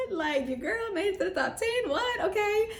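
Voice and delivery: high, tearful squeak of disbelief; can't believe her own name was called; stunned disbelief. Retold or imitated. Called first into top ten.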